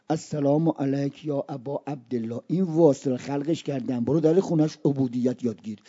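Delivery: low, soft and solemn recitation; gentle divine answer. Acted. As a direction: answer the plea quietly, dropped low. Voices God's gentle answer, reciting. (پاسخی آرام، بم و باوقار)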